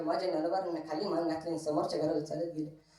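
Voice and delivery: normal talking voice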